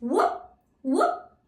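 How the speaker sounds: high, short rising woofs; teacher's high little dog bark; dog bark imitation. Shown as a demonstration. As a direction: imitate a dog in two separate yaps. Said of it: "the dog says".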